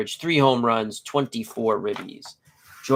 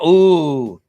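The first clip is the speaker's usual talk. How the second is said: impressed ooh; 3 semitones higher, 9 dB louder than usual. Real, not acted. Reacting to Mets fan.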